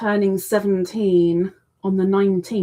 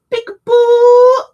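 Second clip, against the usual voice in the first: much higher, louder, slow sing-song; playful peekaboo; 14 semitones higher, 12 dB louder, slower than usual. Acted. Host reading a viewer's greeting as a sing-song peekaboo. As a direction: call it out like playing with a baby.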